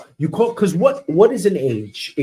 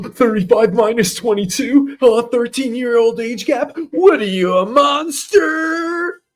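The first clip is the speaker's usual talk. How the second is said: shocked outrage; 6 semitones higher, 3 dB louder than usual. Retold or imitated.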